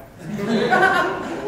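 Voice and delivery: quick high burst of laughter; amused laugh. Real, not acted. Reacts to sherry joke.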